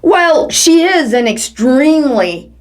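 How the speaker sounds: ordinary delivery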